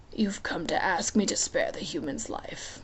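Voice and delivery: amused, lazy menace, half whispered; lightly voiced Hades.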